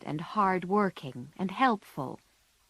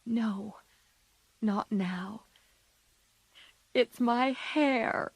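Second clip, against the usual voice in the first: tearful; 3 semitones higher, slower than usual. A narrator's reading. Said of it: "cried Joe".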